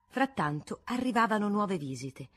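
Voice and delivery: plain reading voice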